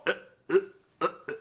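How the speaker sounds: soft breathy grunts; faked rhythmic moaning; mock moans. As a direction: pant softly in a parody of passion. Parodying a love scene.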